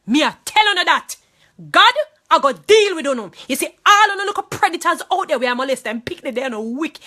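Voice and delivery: high, loud Jamaican patois threat; furious shout. Real, not acted. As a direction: hurl it at the predators. Rages at predators in patois.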